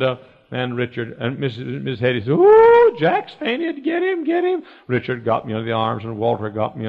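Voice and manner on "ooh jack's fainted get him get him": high-pitched squeal of mock alarm; alarmed teacher. Teacher cries out as Jack faints, mock alarm. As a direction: imitate a panicked teacher, way up high.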